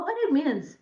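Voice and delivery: usual speaking voice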